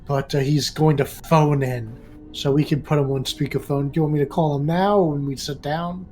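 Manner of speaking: ordinary talk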